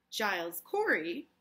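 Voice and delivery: ordinary talk, nothing marked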